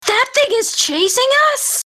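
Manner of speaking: very high-pitched, incredulous outburst; alarmed disbelief. Acted. Player voicing her character on spotting the pursuer, shrill alarmed disbelief.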